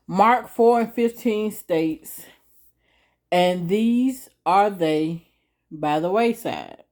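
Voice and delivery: slow, deliberate scripture reading; reading slowly. Shown as a demonstration. Preacher reading the verse slowly to the viewers. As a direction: read each word slowly so they get it. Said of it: "I'm gonna read it a little slow".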